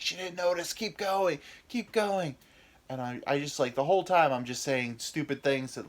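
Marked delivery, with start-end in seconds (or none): 0.0-2.4 high coaxing push; penis coach. Acted. His penis urging him past the blunder, high and coaxing. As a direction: egg him on, higher. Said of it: "and then my penis is like".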